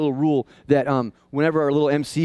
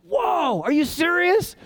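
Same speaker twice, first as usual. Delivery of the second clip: much higher, astonished outburst; amazed excitement; 12 semitones higher than usual. Retold or imitated. Pastor acting his own discovery of grace, high amazed exclamation. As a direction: gasp it like the news just hit you. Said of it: "It's like".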